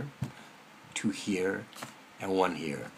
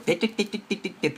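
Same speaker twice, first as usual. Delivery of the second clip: mimicked rapid tapping; 7 semitones higher than usual. Imitates the inner voice's pecking.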